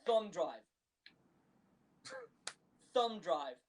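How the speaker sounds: deadpan movie-line imitation; casual, understated delivery; nonchalant. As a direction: toss it off like it's nothing. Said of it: "I love just the nonchalant".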